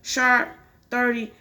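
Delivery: ordinary delivery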